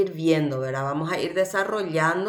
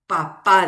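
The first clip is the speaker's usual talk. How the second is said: "papá" with a louder final PA; stress on last syllable; 8 dB louder than usual. Shown as a demonstration. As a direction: punch the second syllable of "papá". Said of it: "La última es la sílaba tónica". ("papá" con la última sílaba tónica)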